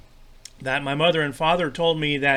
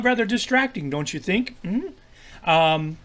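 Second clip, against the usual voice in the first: ordinary talk, nothing marked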